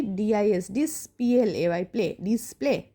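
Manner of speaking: letters of "ডিসপ্লে" by syllable, then the whole word; spelled-out pronunciation demo. Shown as a demonstration. Teacher showing pupils how "ডিসপ্লে" is built, spelled by syllable. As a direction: spell and sound "ডিসপ্লে" in two parts, then join. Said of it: "তাহলে উচ্চারণ কীভাবে করছি".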